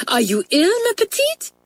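high, concerned French teacher; alarmed cry. A narrator's reading. Mamselle to a shocked pupil, alarmed concern. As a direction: ask with pitch leaping at the end. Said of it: "she cried".

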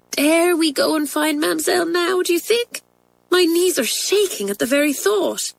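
high, anxious schoolgirl voice; nervous dread. A narrator's reading. Isabel to Pat, scared of facing Mamselle, nervous.

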